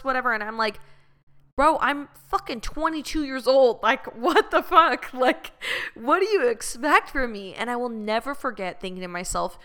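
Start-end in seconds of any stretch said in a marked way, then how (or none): 1.5-7.5 higher, laughing disbelief; incredulous retort. Retold or imitated. Twenty-two-year-old answering her ranting ex, incredulous and laughing.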